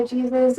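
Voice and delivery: Jesus said JEE-zuss, with a J; English pronunciation. Shown as a demonstration. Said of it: "Uh, the Spanish name".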